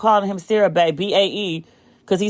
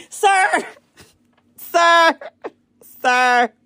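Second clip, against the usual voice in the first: laughing high sir, sir, sir; cracking up, calling him out; 8 semitones higher, much slower than usual. Real, not acted. Laughing at Obama's flirting, sir sir sir.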